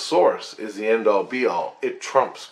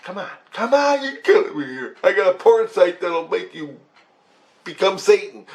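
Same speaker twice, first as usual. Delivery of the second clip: high, loud, pleading exasperation; 9 semitones higher, 5 dB louder than usual. Retold or imitated. Speaker begging grandpa Christ to stop, high loud exasperation. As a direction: beg him to stop, higher and louder. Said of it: "I'm like".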